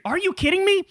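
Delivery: high, outraged disbelief; real disbelief pitched high; incredulous squeal. Real, not acted. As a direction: throw it up in shock. Incredulous outburst.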